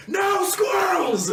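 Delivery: high, slow shout of no squirrels. Retold or imitated. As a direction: yell it out, stretched and high. Band guy shouting, quoted.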